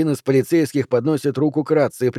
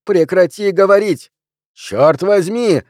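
high, loud desperate yell; shouting; 7 semitones higher, 6 dB louder, slower than usual. A narrator's reading. Narrator shouting at the voice in his head, loud and high. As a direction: cry out loud to drown the voice. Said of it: "закрываю уши руками и кричу".

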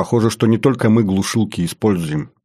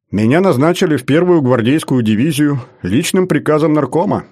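raised voice with a note of pride; proud, higher-pitched announcement; 7 semitones higher, 4 dB louder than usual. Acted. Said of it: "с ноткой гордости сказал Лерман".

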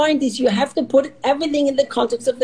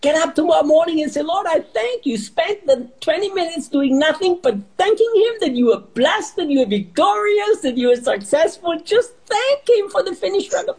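high, fervent preacher voice; preaching; 7 semitones higher than usual. Real, not acted. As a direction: preach it with rising fire. Preaching at full force.

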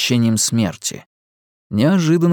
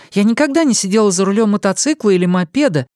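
higher female voice, fast and eager, stress on никогда; eager young woman; 9 semitones higher, faster than usual. A narrator's reading. Young woman admits she has never ridden, quick and eager. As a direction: rush it out, thrilled at something new.

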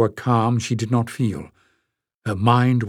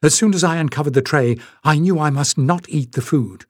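fast, rushed burst of words; tumbling out her confession quickly; 4 semitones higher than usual. Acted. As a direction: let it all spill out at once. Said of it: "the words at last tumbling out".

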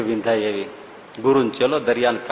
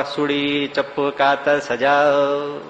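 slow chanted street call; knife-sharpener hawker's cry; much slower than usual. Retold or imitated. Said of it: "સજાવા વાળા".

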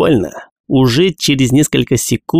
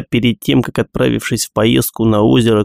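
usual speaking voice

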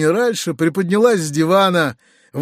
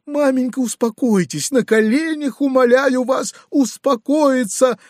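much higher, desperate begging; pleading son; 9 semitones higher than usual. A narrator's reading. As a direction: beg her on your knees. Uncle pleading with mother. (сын умоляет мать успокоиться)

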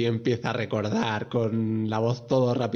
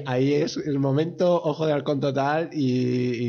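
usual speaking voice

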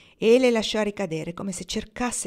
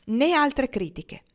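dry and curt, pitched higher; 6 semitones higher, 4 dB louder than usual. A narrator's reading. A woman refusing further criticism, dry and curt. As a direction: cut it off sharply, a woman drawing a line. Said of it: "in tono secco".

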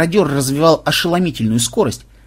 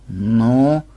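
low, let-down sigh; deflated, lower voice; disappointed sigh; 3 semitones lower than usual. A narrator's reading. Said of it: "разочарованно вздохнула внучка".

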